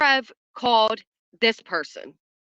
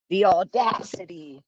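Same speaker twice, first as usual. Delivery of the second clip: mock indignation; 3 semitones lower, slower than usual. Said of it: "I'm gonna read this in dramatic style".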